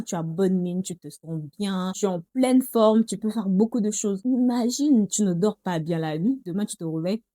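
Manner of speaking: ordinary talk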